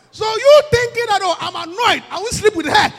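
a preacher scolding the husbands loudly; preaching shout. Real, not acted. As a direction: hammer it home at full voice. Preaching at full force.

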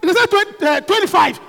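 ordinary talk

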